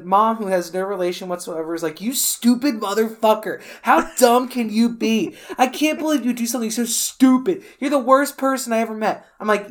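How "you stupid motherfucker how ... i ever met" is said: imitated no-nonsense telling-off; higher, quick mock berating from a mother; blunt scolding mom. Mom tells Danny off on the roof, blunt scolding. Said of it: "she's so matter of fact".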